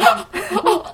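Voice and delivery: a sudden high laugh; high amused laugh. Real, not acted. 听到妈妈骗小孩. (高声大笑)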